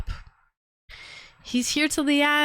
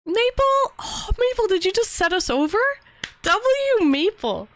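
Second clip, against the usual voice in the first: excited; 9 semitones higher than usual. Real, not acted. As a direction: call out the name in delight. Surprised at new gift.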